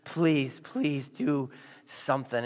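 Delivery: soft, broken pleading, slow at the end; desperate begging. Retold or imitated. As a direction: beg quietly, at the end of your rope. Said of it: "on his hands and knees just begging".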